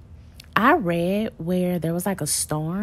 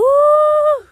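excited laugh; 12 dB louder than usual. Real, not acted. Cheering kid down slide.

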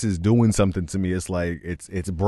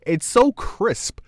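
real amazed shout; 6 dB louder than usual. Real, not acted. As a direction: shout in disbelief. Amazed shout about the singer.

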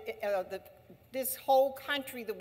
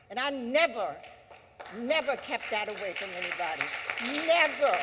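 emphatic repeated never; stress on never; 4 dB louder than usual. Real, not acted. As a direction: insist on each never. Insisting she never hid it.

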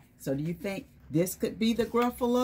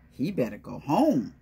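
plain reading voice

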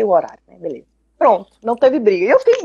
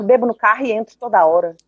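normal talking voice

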